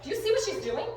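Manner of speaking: high, mock-outraged dog voice; indignant dog. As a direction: voice the dog's scandalized complaint. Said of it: "He's like".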